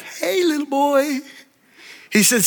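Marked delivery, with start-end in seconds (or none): 0.0-1.2 softer, slower, distant echo of the call; cave echo. Retold or imitated. Cave echoes the boy's call, faint and distant. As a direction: let it come back faint from far away. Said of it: "And the voice came back".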